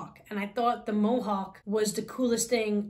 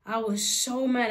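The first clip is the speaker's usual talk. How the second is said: normal talking voice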